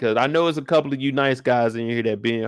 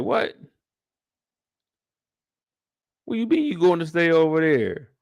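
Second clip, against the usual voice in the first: jumping high in disbelief; a shocked husband, hearing she moves out; mock disbelief; 5 semitones higher than usual. Husband to wife, moving to her mama's: shocked disbelief. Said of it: "He like".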